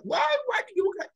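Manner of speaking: high, quick mock-wife question; startled questioning. Wife asks husband why he carried her, startled questioning. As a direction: imitate a wife's high-pitched, hurried protest.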